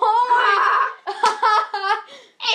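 shrieking laugh; loud laugh. Real, not acted. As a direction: burst out laughing loudly. Kids laughing loudly.

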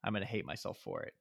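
quiet inner voice. Retold or imitated. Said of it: "and it's like".

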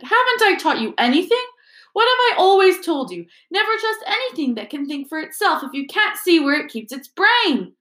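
shocked and scolding at once; flabbergasted scolding. Acted. Mr Weasley scolding Ginny about the diary, flabbergasted.

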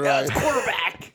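mock American quarterback voice; quarterback talk. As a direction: parody a quarterback's bellow. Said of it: "quarterback talk".

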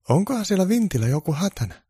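high elf voice asking; elf's high, concerned question; worried elf. Acted. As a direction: play a small worried elf. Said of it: "Mauri tonttu kysyi".

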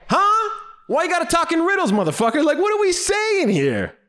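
very high-pitched incredulous outburst; hammed-up high-pitched confusion; baffled exasperation. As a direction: squeal in comic bewilderment. Baffled outburst at the dad's riddle.

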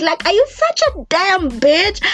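high, exasperated self-scolding; high irritated scolding; mad at herself. Retold or imitated. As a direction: scold yourself in disbelief. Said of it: "I was getting so mad at myself".

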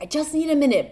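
high, sharp cry of I just need a minute; mock yell for space; retold scream. Mom retelling snapping at her kids, a mild mock yell. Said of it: "but, like, I scream".

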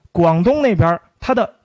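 ordinary talk, nothing marked

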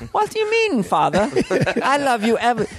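put-on very high English little-boy voice; prim, high British son; dainty British child. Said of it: "You sound kinda dainty".

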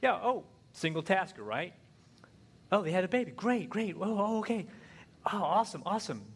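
high, bright oh's tossed off absently; distracted husband. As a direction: fake enthusiasm while watching the game. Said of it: "I'm like".

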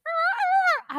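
high-pitched mock wail of a pooping dog; imitated dog yelp. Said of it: "he was like".

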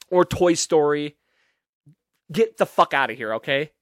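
ordinary talk

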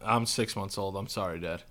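very low, soft grown-up voice; mock-solemn deep-voiced infant; adult-talking baby. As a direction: play the baby as a low, quiet man. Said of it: "literally like that".

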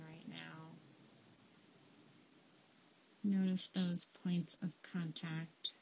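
calm them down with a quiet voice; guided meditation. Acted. Leads a breathing meditation.

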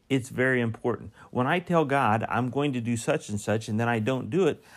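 usual speaking voice

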